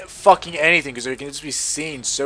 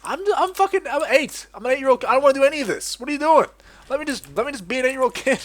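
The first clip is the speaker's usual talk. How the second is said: put-on much higher, whining child's protest; whiny kid; 12 semitones higher than usual. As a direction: whine like a fed-up eight-year-old. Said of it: "And you're like".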